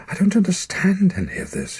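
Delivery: high, loud frightened cry. Acted. Frightened boy begs his dying father to explain, crying out high and loud. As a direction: cry out in bewildered fear. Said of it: "he cried".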